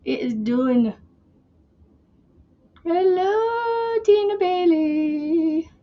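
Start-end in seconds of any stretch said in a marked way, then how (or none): 2.8-5.7 high, slow sing-song hello to a viewer; sing-song greeting. Real, not acted. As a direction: stretch the name out like a cheerful call.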